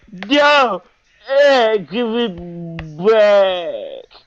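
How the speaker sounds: loud, high wail, hugely drawn out; hammed-up bawling; mock crying yell. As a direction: imitate a crying tantrum, stretching each word. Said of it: "starts crying, yelling".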